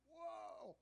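high startled whoa, a quick mock gasp. Young man startled when the dad grabs Frankie, high whoa. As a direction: jump back in surprise. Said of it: "I'm like".

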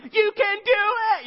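high, loud, slow stretched cheer; cheering parent. Retold or imitated. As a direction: yell it from the sideline at the soccer game. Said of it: "and I'm like".

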